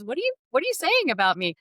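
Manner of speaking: quick, high, playfully offended question; mock offended. To the interviewer, mock affront at being called a warrior. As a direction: toss it off as a fake affront. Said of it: "mock offended quote".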